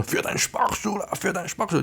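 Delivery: high-pitched imitated crowd calls; mock rallying cry; cheering on. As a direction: call it out like players tossing chips. Players throw chips, cheering dealer on.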